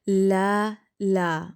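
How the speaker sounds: "لا" held open, with no nasal ending; a steady oral 'laa' on "لا"; clean la. Shown as a demonstration. Teacher modelling the first word for pupils, clean la. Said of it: "لا کرنا ہے".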